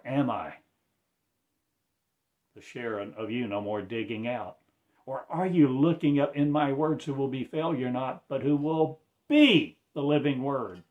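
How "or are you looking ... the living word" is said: loud, rising sermon proclamation; fervent preaching. Acted. Preacher proclaiming the living word, loud and fervent.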